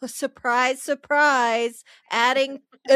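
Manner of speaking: sarcastic. Real, not acted. Sarcastic surprise.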